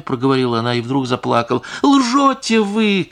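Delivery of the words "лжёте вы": high, slow, loud accusing cry; angry shout. A narrator's reading. Wife shouting at the singer that she lies. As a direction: shout her down as a liar. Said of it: "крикнула барыня и злобно".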